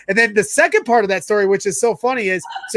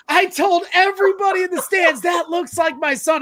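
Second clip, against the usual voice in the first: much higher, calling out proudly; excited shout; 10 semitones higher than usual. Retold or imitated. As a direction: yell it across the stands. Mom retells shouting in stands.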